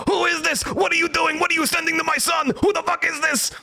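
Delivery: friend's dad yelling down the phone, high mock rant; imitating screaming Indian dad. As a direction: squeak it out like a furious dad on the phone. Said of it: "I hear an Indian guy start screaming at me".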